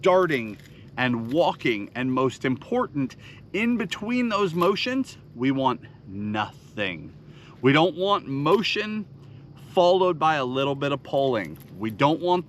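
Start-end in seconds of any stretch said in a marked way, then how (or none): 5.3-7.1 slow, soft, "nothing" drawn out and dropped low; stress on nothing. Shown as a demonstration. Teaching anglers the pause, slow soft stress. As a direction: let "we want nothing" sink, slowly and quietly. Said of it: "stressing stillness between motions".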